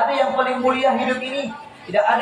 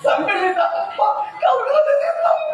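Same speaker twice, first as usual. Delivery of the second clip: punchline repeated higher, laughing. Retold or imitated. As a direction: crack up at the joke again.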